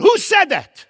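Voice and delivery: high, loud outraged yell; angry shout. Retold or imitated. Teacher yelling at the class, an angry shout. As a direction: demand to know who spoke, shouting. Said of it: "He screams out".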